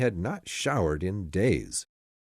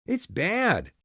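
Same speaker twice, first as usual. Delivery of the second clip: put-on high upset cry from a simple boy; upset shout; 11 semitones higher than usual. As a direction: call out in distress, pitched up. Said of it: "shouted Stevie".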